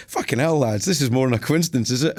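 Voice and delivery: hamming up the shock; mock astonishment. Retold surprise at band arriving.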